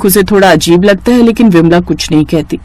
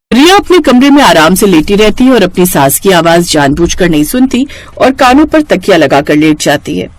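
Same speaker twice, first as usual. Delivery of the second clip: usual speaking voice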